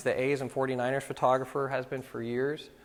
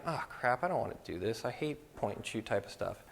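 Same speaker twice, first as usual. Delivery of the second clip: softer, muttered inner grumble; inner thought; 3 semitones lower, 9 dB quieter than usual. Retold or imitated. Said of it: "And inside I'm thinking".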